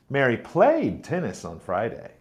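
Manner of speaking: played said strong and long, Mary light; stress on played. Shown as a demonstration. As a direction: hit the word played. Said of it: "Mary played tennis, not watched".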